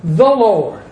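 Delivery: "The" lifted and pressed before a falling "Lord"; emphatic "The", then fall on "Lord"; stress on THE. Shown as a demonstration. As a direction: hit the word "The". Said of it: "emphasize the word "the".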